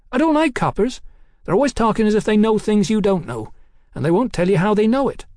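put-on high, quick, complaining girl's voice; rapid high-voiced London girl. A narrator's reading. Hetty complains about coppers.

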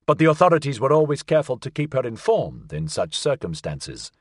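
usual speaking voice

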